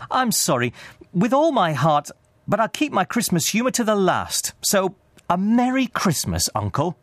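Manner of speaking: high, heartfelt, undaunted nephew; earnest cheerful nephew. A narrator's reading. Nephew parts from stubborn uncle, heartfelt and cheery. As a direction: keep up the good cheer earnestly.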